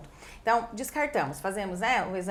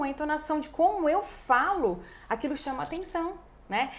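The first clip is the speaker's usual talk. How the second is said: normal talking voice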